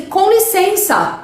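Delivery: slow, high polite ask; gently drawn-out polite request. Shown as a demonstration. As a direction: ask sweetly and slowly, like a polite passenger. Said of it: "você vai pedir assim".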